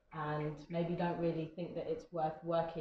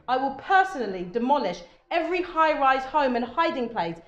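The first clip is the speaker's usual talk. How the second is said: loud, emphatic spoken-word threat, higher pitched; very angry; 9 semitones higher, 11 dB louder than usual. Acted. Poet performs Unnatural Woman to audience, very angry. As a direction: declaim it as a furious warning, stretching key words. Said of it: "makes me sound like a very angry".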